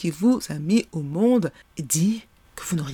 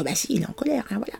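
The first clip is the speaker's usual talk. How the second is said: ordinary talk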